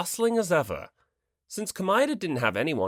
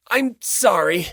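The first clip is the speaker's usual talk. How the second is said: put-on small, slow apology like a scolded kid; a hint of a child saying sorry, slowly; childish apology; 7 semitones higher, 8 dB louder, slower than usual. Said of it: "The childish apology".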